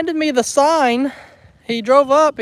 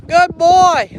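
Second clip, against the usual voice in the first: praise; 7 semitones higher, 6 dB louder than usual. Real, not acted. Praising an animal for obeying.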